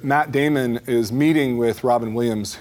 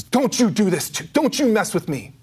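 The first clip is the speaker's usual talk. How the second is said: angry outburst; 9 semitones higher than usual. Retold or imitated. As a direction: snap back at him in anger. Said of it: "that confusion turns into anger".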